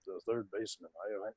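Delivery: very low, soft funereal announcement; sounding like death. Shown as a demonstration. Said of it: "just sounding like death".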